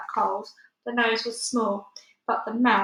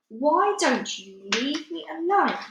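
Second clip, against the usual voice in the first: high, slow pleading giant voice; pleading whine; 6 semitones higher, slower than usual. Acted. The BFG pleading with the Bloodbottler, high slow plea. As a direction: beg to be left alone.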